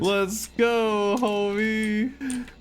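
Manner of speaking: high hyped-up shout; excited cheer. Real, not acted. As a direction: cheer the big win. Celebrating a stunt.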